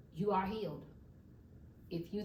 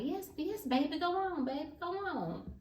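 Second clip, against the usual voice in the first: put-on much higher, little old lady voice, kindly waving her on; sweet old lady; 10 semitones higher, 3 dB louder than usual. Old lady in the hallway telling her to go on, high and kindly.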